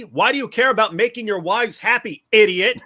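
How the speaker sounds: exasperated, hammed-up rebuke; mock scolding. Allah scolds the prophet, loud mock rebuke.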